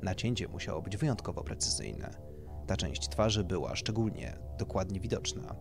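plain reading voice

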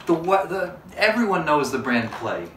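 ordinary talk, nothing marked

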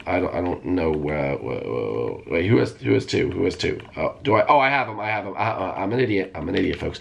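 ordinary talk

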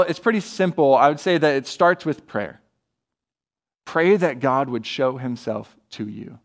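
ordinary talk, nothing marked

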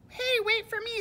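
high, loud plea to wait; pig calling out. Acted. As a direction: shout after the departing cart. Pig calls after the cart.

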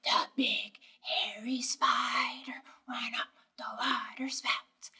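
big hairy spider whispered song; slow and quiet. Shown as a demonstration. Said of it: "he's slow and he's quiet".

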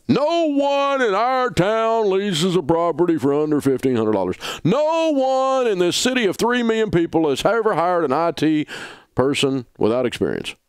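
high, hammed-up melodramatic complaint; mock the caller's overblown claim; mock drama queen. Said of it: "turn into a drama queen like that".

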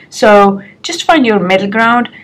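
usual speaking voice

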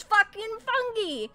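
fungi as FUNG-ghee, hard g; insisted pronunciation. Shown as a demonstration. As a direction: say fungi firmly with a hard g and an ee ending. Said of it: "You don't pronounce it fungi?".

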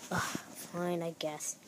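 grudging sigh, quiet; feigned reluctance. Pretend. Reluctantly accepting the room.

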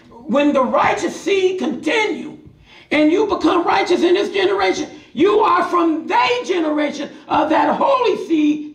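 high, stretched-out sermon delivery; full-force preaching. Real, not acted.

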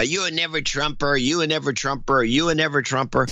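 repeating it like a grilling; hammed-up accusing chant; mock Swalwell grilling. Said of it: "Swalwell grilling".

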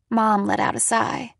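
usual speaking voice

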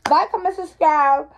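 projected vlogger plug; loud sign-off. Real, not acted. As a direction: announce it loud and slow to the viewers. Sign-off said aloud to camera.

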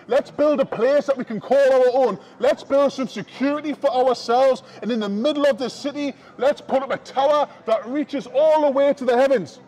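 usual speaking voice

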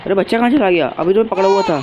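spooked, can't believe it's gone; startled. Real, not acted. Baby disappeared again.

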